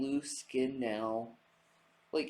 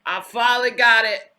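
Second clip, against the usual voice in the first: put-on Southern accent; 9 semitones higher, 14 dB louder than usual. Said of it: "He's like".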